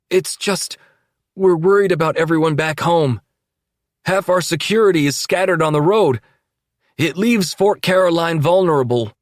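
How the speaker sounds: high, loud worried plea; deputy voicing worry to his boss; hesitant deputy. A narrator's reading. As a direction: speak up nervously. Nervous deputy speaking up.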